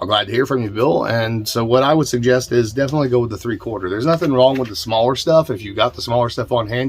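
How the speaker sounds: ordinary talk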